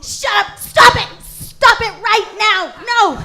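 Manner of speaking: loud, high exasperated yelling; angry director. Acted. Director yelling at volunteers to stop a terrible scene, angry.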